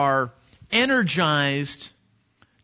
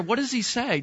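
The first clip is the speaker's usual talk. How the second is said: usual speaking voice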